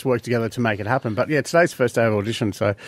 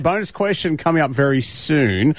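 upbeat breakfast radio read; bright morning radio host voice; radio announcer; 5 semitones higher than usual. Acted.

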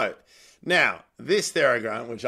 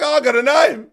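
higher and louder, hammed-up delight; mock thrilled; 12 semitones higher, 9 dB louder than usual. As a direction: overplay the joy at having a nickname. Mock delight at getting a nickname.

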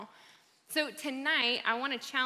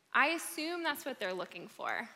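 ordinary talk, nothing marked

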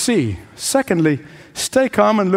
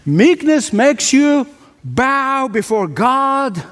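raised pitch, measured pulpit cadence; high, deliberate sermon oratory; emphatic preaching; 4 semitones higher, slower than usual. Real, not acted. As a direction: drive the maxim home phrase by phrase. Preacher drives home a maxim.

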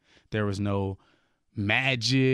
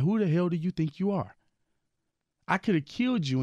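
higher, rebuking voice of God; retelling God's stern rebuke, pitched up; 8 semitones higher than usual. Retold or imitated. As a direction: rebuke him as God, incredulous. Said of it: "he was just like".

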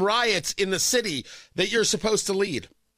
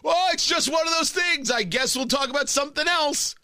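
mocking dismissive voice; 7 semitones higher than usual. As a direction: toss it off like a celebrity dodging the question. Mocking Chappelle's dismissive answer.

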